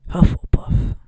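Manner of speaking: loud announcing shout. A narrator's reading. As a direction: call the house out loud. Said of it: "shouted the hat".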